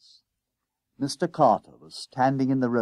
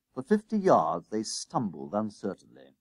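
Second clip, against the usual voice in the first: ordinary delivery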